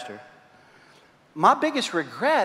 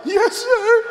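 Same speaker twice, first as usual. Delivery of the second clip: little daughter near tears. Retold or imitated. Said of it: "make her cry".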